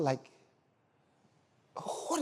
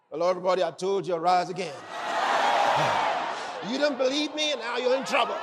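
bossy scolding; faster than usual. Acted. Jesus imagined as a scolding boss to his disciples. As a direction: play a smug boss telling them off. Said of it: "he doesn't talk like".

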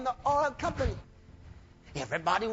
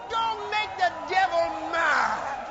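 preaching shout; 6 semitones higher, slower than usual. Real, not acted. Preacher warns the congregation, slow high shout. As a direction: hammer it home slowly.

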